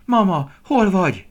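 high, drawn-out shout; shouted call. A narrator's reading. Young deer searching for his mother, shouting high. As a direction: call slowly and loudly for a lost mother. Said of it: "kiáltotta".

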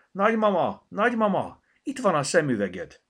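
high, loud call to someone away; excited calling. A narrator's reading. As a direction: call out the good news. Calls grandma with good news. (hangos, izgatott odakiáltás)

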